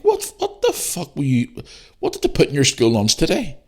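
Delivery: high-pitched, acted disbelief; incredulous parent. Parent reacting to child claiming to be a dinosaur, incredulous. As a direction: voice shoots up in baffled outrage.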